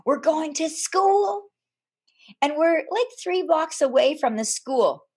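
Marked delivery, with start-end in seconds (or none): 0.0-1.5 higher, slow singsong mom voice; excited mom. Retold or imitated. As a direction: sell it like the best news of summer. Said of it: "our moms told us".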